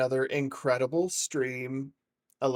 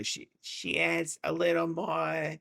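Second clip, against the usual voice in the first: higher, slow, a little more gravelly; Fran Drescher; 6 semitones higher, slower than usual. Shown as a demonstration. Streamer working out a TV star's voice, gravelly impression. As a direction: drag it out in a raised gravelly voice. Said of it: "It's a little more gravelly".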